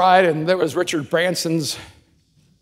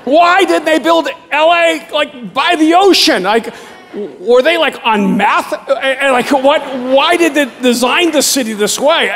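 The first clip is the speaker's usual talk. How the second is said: higher, louder, incredulous ranting; exasperated rant; 7 semitones higher, 4 dB louder than usual. Real, not acted. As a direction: vent your disbelief at the city, loud and high. Ranting about ugly LA.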